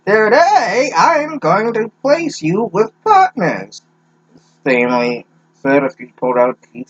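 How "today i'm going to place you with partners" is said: put-on high, slow old teacher voice; creaky elderly teacher announcing; old person voice. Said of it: "I need to have a old person voice".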